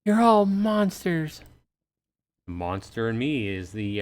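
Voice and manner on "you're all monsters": high slow accusation; bitter woman. Acted. Woman condemning them.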